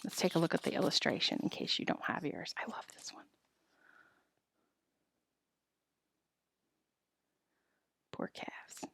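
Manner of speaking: very soft, off-script murmur; hushed little comment; quiet aside. Real, not acted. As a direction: drop right down to chat about the picture. Aside while showing the picture.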